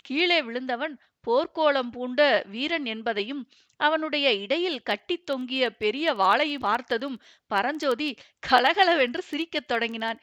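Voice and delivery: ordinary delivery, nothing marked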